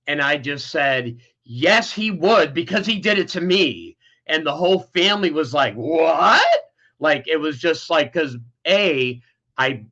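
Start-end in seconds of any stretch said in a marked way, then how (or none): 1.4-4.0 retold angry outburst. Nephew finally telling the whole family, retold explosive outburst. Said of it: "something inside of me just, like, exploded". 5.7-6.4 loud, rising disbelieving cry; retold shock. Family reacting to nephew's revelation, retold loud shock.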